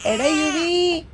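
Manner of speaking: playful summons to someone away; calling out. Pretend. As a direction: call for someone to come over. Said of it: "യുവിയെ വിളിക്കും".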